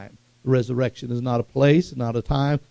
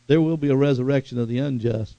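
ordinary delivery

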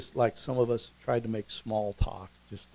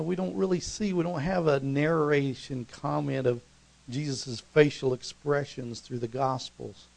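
normal talking voice

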